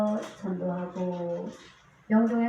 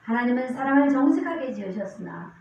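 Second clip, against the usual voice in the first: raised, declamatory Bible reading; scripture reading; 5 dB louder than usual. Acted. Preacher reading verses to the congregation, declamatory.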